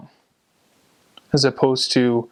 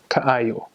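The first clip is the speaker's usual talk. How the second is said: slow "kaayo" with the "ai" sound stressed; stress on second syllable. Shown as a demonstration. Teacher showing where emphasis falls, "kaayo" stressed on second syllable. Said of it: "or the second syllable. So you say".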